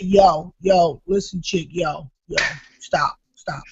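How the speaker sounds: low, softened interjections; retold calm-down. Comic acting out stopping the jealous wife, low and quiet. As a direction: cut in softly and low, as if halting someone. Acted attempt to stop wife talking.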